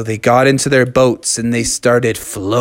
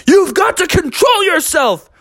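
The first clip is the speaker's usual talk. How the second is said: high, urgent reprimand; exasperated scolding. Acted. Father Frog to gold-crazed Brother Bear, high exasperated order. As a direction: scold him, voice pitched way up. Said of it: "Father Frog was like".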